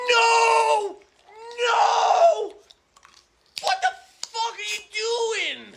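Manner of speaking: high, loud enraged shrieks; furious screaming. Acted. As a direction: scream in outrage at the loss. Opponent's combo wins; he loses it.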